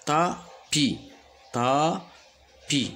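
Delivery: "tapis" broken into ta, pause, pi; syllable by syllable. Shown as a demonstration. Teacher showing the two syllables, syllable by syllable. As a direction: slowly separate both syllables of "tapis". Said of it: "Le mot tapis contient deux syllabes".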